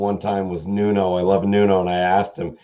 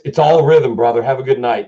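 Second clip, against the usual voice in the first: put-on Boston accent; 2 semitones higher, 4 dB louder than usual. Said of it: "And he goes".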